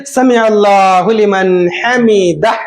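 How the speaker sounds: sami Allahu liman hamida, intoned; measured, chanted prayer phrase; prayer recitation. Shown as a demonstration. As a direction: recite it as the imam rising from ruku. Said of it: "फिर रुकू से उठेंगे".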